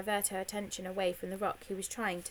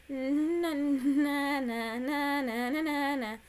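sing-song na-na teasing, higher and louder; childish taunt; 6 semitones higher, 4 dB louder, much slower than usual. Acted. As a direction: tease him like a schoolyard brat. Annie taunts George in helmet.

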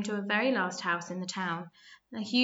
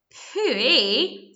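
disgusted exclamation; 5 semitones higher, 9 dB louder than usual. A narrator's reading. Devil smells something foul.

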